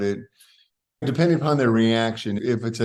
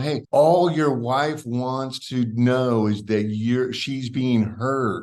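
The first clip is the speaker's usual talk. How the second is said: slow coaching; much slower than usual. Retold or imitated. Coaching husband what to say.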